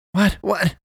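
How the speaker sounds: high, urgent questioning. A narrator's reading. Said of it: "Sam asked urgently".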